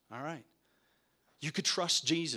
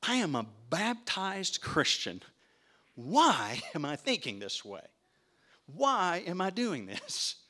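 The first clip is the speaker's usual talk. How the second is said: slow, raised, emphatic self-reminder; firm declaration; 5 semitones higher, 4 dB louder, slower than usual. Retold or imitated. Preacher voicing Luther's words, slow and emphatic. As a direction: declare it boldly, like a creed. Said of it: "Luther that said, uh, that would say these words".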